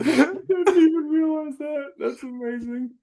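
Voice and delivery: very high squeal through laughter; real laughing, voice shooting way up; helpless laughter. Real, not acted. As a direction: squeeze the words out while cracking up. Cracking up at the detail.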